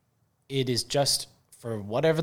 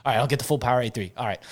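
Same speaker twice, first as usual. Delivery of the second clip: hurried reply; much faster than usual. Retold or imitated. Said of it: "he's like".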